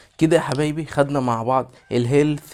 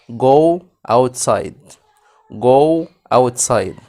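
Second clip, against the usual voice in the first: slow drilled model; 6 dB louder, much slower than usual. Shown as a demonstration. Teacher drilling kids in English, slow loud model. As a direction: Go outside dragged out slow and loud, GO stressed. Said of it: "repeat drill".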